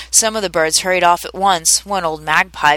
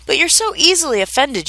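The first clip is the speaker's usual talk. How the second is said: higher-pitched protesting plea; pleading; 7 semitones higher than usual. A narrator's reading. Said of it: "pleaded poor Alice".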